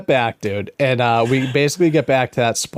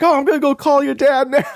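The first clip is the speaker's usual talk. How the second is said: high, excited mock holler; calling out; 12 semitones higher, faster than usual. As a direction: imitate a friend calling over. Friend calls across the water.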